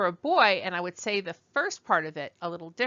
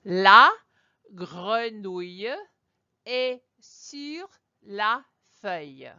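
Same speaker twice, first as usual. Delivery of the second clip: very slow French, word by word; slow French demonstration; 6 dB louder, slower than usual. Shown as a demonstration. Teacher saying the frog sentence out loud for learners, slow French. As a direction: model the French sentence slowly with pauses. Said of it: "I will repeat that one more time".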